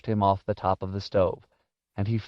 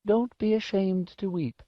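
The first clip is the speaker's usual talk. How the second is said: put-on high, gentle old-lady voice; tender elderly woman; comforting old woman; 11 semitones higher than usual. A narrator's reading. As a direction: pitch it up and reassure a child. Old woman comforts crying boy.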